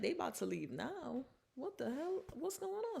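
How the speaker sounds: climbing up in shock, what's happening; shocked disbelief. Retold or imitated. Bayou stunned that Leanne is leaving, alarmed rising pitch.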